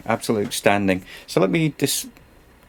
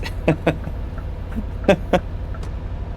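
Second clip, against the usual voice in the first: helpless, breathy chuckle; laugh; 8 semitones higher, 3 dB quieter than usual. Real, not acted. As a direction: laugh it off quietly after a mishap. Amused after his near disaster.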